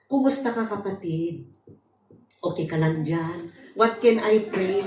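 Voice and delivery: very soft, low, gentle greeting; sweet. Acted. Church member greets a sensitive newcomer, softly and sweetly. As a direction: welcome them tenderly, almost hushed. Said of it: "sweet tayo".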